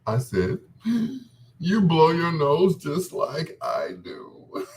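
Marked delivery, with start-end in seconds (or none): 1.6-4.8 slow, words broken by laughter; can barely get it out for laughing; giggling through the line; laughing while talking. Retold or imitated. Laughing at daughter.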